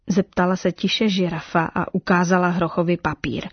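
usual speaking voice

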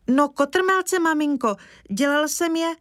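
put-on high, eager boyish boast; bursting to tell about his somersaults; proud excited boy. A narrator's reading. Boy proudly telling his mother about his somersaults, high and eager.

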